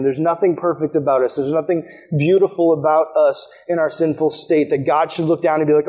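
ordinary talk, nothing marked

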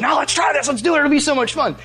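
high-pitched, excited urging; eager prankster. Retold or imitated. Prankster friend coaxing his cautious companion, excited. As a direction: imitate an eager friend talking someone into mischief.

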